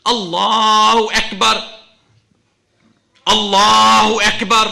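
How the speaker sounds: loud, high, drawn-out call to prayer; adhan call. Acted. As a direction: proclaim it slowly like the muezzin. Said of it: "I heard the adhan commencing for Fajr".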